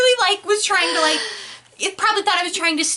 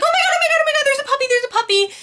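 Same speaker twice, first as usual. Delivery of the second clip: retold squeal of excitement; 9 semitones higher, much faster than usual. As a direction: squeal like you just saw a puppy. Spotting a puppy by the road.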